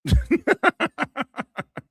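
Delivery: a sudden shrill cackle of real laughter; high burst of laughter. Real, not acted. Streamer cracking up at a song made for another dude, high laughter. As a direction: lose it and laugh high and loud.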